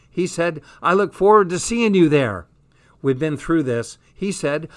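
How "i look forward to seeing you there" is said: higher and louder, swooning; replaying his words in delight; gushing excited. Jazz swooning to her friend over Tom's words.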